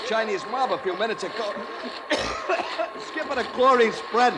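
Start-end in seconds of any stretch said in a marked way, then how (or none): 1.6-3.0 harsh airless cough in the gas; choking cough. Acted. Officer coughing through chlorine gas, choking cough. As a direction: cough as the chlorine catches the throat.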